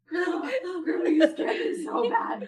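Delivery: high, panicky mimicry through laughter; imitated hyperventilating. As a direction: gasp it out like a scared grandchild, laughing. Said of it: "He was hyperventilating".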